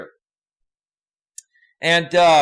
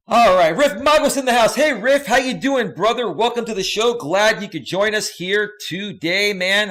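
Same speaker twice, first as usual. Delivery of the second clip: excited; 6 semitones higher, 5 dB louder than usual. Real, not acted. Loud excited greeting.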